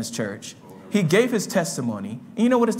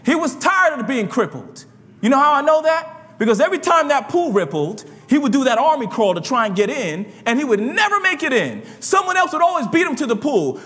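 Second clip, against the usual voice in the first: fiery preaching; 6 semitones higher than usual. Acted. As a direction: preach it out to the church with fire. Preaches at full force.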